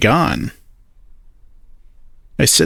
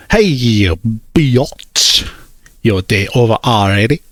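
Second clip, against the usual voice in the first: slow, lisping teasing friend. A narrator's reading. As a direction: drag the words with a lisp. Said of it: "his voice drops the lisp".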